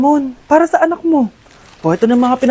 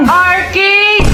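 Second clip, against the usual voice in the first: shout; 4 semitones higher than usual. Acted. Friends argue over plans.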